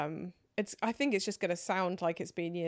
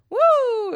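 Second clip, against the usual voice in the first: high loud whoa-howl; wolf howl woo; 15 dB louder than usual. Shown as a demonstration. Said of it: "a whoa and a wolf howl mixed together".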